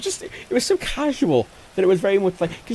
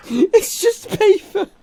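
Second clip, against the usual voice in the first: 1 laughing disbelief; 13 semitones higher, 5 dB louder, much slower than usual. Real, not acted.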